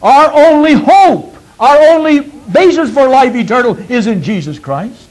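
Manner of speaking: high, loud preaching climax; preaching at full force. Acted. Preacher proclaiming to the congregation, high and loud. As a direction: lift the congregation up, ring it out.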